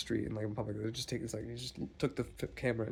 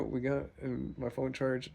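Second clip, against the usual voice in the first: ordinary delivery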